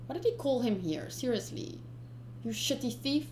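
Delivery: usual speaking voice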